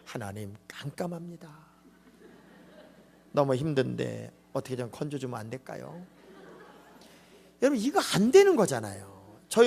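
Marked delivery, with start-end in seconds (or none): 0.1-6.1 soft, polite, half-laughing mock prayer; mock genteel prayer. Pastor mocks a polite prayer from the fish's belly, softly amused. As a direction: pray far too daintily, tongue in cheek. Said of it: "무슨 고상하게".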